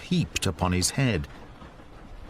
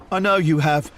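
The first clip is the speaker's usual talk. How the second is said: a louder, breathless gasp; 5 semitones higher, 5 dB louder than usual. Acted. Frightened visitor to Holmes, gasping louder. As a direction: blurt it out, gasping. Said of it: "our visitor gasped".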